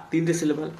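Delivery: normal talking voice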